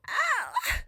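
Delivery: a sudden high squeal of excitement; tiny thrilled yelp; excited squeal. Retold or imitated. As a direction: let out a high little shriek. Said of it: "I did start to get a little".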